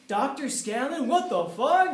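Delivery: slow, high, rising into a shout on fuck; mock outrage. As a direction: bellow his title as a crowd-pleasing punchline. Mocking his own title.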